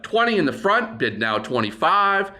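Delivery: high call of the front bid, then ask; auction bid call. Shown as a demonstration. Auctioneer demonstrating a stalled bid, calling it out. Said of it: "if the bid is stalling".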